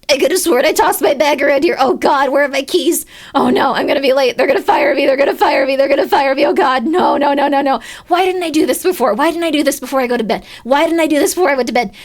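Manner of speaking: hammed-up panic over lost keys; panicked. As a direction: rush it like you're late. Panicked search for bag.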